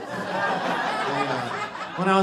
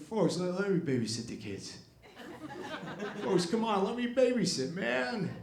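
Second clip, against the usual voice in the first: higher, soft folksy grandpa voice; Biden impression; 6 semitones higher, 5 dB quieter than usual. Biden as grandpa offering to babysit, folksy. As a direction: coax gently like a rambling grandpa. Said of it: "grandpa who was just like".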